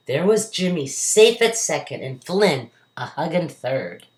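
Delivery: ordinary delivery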